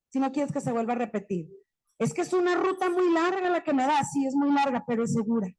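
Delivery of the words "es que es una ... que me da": lightly higher mock complaint; complaining teacher. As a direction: play the skeptical colleague objecting. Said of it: "objeción de la maestra". (queja de maestra, un poco más aguda)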